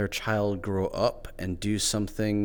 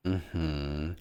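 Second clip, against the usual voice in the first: ordinary delivery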